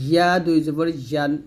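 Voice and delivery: ইয়া with a firm y from mid-tongue to palate; yā sound. Shown as a demonstration. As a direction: glide clearly into ইয়া and ইয়ান. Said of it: "তারপর উপর তালুর সঙ্গে লাগা". (ইয়া জিহ্বার মাঝখান তালুতে লাগিয়ে)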